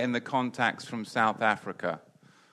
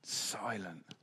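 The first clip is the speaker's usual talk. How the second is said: whisper; 3 semitones lower, 10 dB quieter than usual. Real, not acted. No answer from the room.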